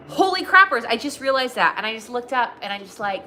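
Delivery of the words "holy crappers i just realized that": excited realization. Real, not acted. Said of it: "sudden excited realization".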